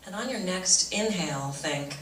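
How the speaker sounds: soft, low meditation voice with long pauses; guided meditation. Real, not acted. Teacher leads audience through breathing meditation, soft guided-meditation voice. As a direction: guide them gently into calm, hushed.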